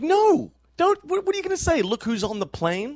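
very high, incredulous squawk; acted-out high-pitched disbelief; mock protest. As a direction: protest loudly, pitch shooting way up. Acting out family's protest at announcement.

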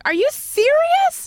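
high, slow stunned disbelief; disbelieving. Retold or imitated. Comic retelling her shock at a friend, high and slow. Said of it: "I'm like".

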